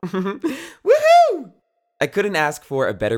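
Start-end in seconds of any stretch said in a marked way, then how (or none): 0.0-1.5 high, loud laughing cheer; laughing woohoo. Real, not acted. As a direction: whoop with joy after a laugh. Birthday cheer.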